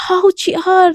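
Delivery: sudden loud pained ouch; sharp hurt yelp. Acted. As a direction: cry out as the glass cuts her hand. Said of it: "Devika एकदम से चिल्लाती है".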